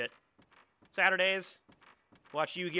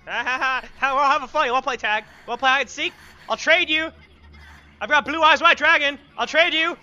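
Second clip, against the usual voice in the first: loud, higher teasing; playful taunting; 6 semitones higher, 12 dB louder than usual. Real, not acted. Mock taunting the ghost children.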